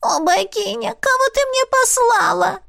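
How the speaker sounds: very high, blurted despair; exasperated otter squeak. A narrator's reading. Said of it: "выпалила она".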